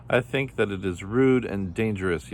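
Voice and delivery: ordinary delivery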